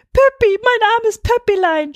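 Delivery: very high, loud wail for a pet; shrieking. A narrator's reading. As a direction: cry out in distress for your little dog. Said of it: "schrie Tante Ida". (schrill kreischend rufen)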